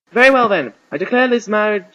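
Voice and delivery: loud, high official proclamation; archbishop declaring. A narrator's reading. Archbishop ruling on the wedding, loud and declamatory. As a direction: ring it out as a ruling.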